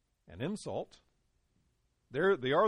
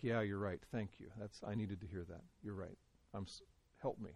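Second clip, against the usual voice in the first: quieter, meek acceptance of a rebuke; humble soft reply; 6 semitones lower, 9 dB quieter than usual. Retold or imitated. A friend with a soft conscience, accepting the rebuke, speaks quietly and meekly. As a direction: give in gently, thankful and humble. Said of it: "the person with a soft conscience says".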